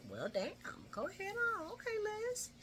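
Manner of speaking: high, soft falsetto reaction; impressed squeal. Retold or imitated. Viewer egging Liz on as she comforts Carly, impressed squeal. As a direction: squeak out playful approval.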